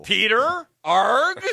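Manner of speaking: high, loud mock pirate squawk; Dodgeball movie pirate. Co-host imitates Dodgeball clips for laughs, high pirate cry. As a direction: call it out like a hammed-up movie pirate. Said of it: "now he's doing dodgeball clips".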